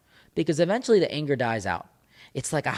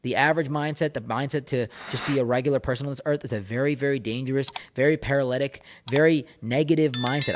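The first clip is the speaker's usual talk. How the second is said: ordinary talk, nothing marked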